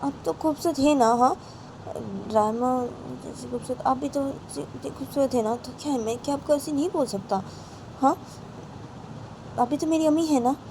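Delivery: high, softened coaxing; acted coaxing. Bani sweet-talking ammi, high acted coaxing.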